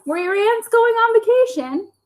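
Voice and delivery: high chirpy hen. Acted. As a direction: ask like a chatty hen. Hen voice continues.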